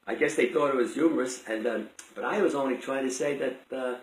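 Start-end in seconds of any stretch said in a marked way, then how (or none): none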